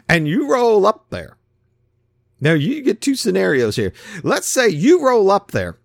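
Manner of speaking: ordinary talk, nothing marked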